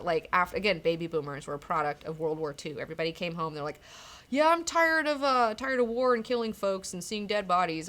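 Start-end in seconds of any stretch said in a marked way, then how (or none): 4.3-7.9 lightly voiced, higher and louder homecoming soldiers; mock complaint of folks sick of war; returning soldiers. Voicing war-weary soldiers coming home.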